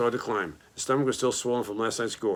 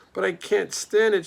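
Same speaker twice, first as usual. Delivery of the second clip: high, loud, slow desperate shout; 9 semitones higher, 5 dB louder, slower than usual. A narrator's reading. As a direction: cry out that you can't bear it. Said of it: "shouted Wilbur".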